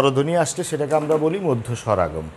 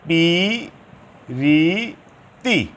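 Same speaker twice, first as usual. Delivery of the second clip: syllable by syllable; much slower than usual. Shown as a demonstration. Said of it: "নিজেটা ভাঙিয়ে দেখবে".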